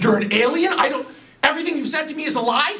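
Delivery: can't believe it, almost laughing; stunned disbelief. Acted.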